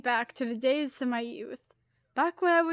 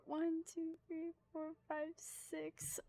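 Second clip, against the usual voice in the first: much softer, fading to a breath; near-whispered counting; 3 semitones higher, 17 dB quieter, slower than usual. Real, not acted. Streamer recounts the badges, fading whispered count. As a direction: count under your breath, trailing off.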